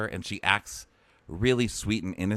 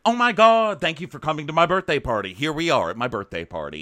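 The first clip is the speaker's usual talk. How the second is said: put-on always-on party girl; 6 semitones higher than usual. Imitating a girl hosting her birthday party, mock gushing. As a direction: gush and host your own party, hammed up. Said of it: "she's that girl who's like".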